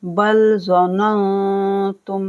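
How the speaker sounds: ज़ननतुम with a hummed, hidden nun; slow recitation with ghunna; nasal ghunna. Shown as a demonstration. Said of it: "इख्फा किया जाएगा गुन्ना के साथ".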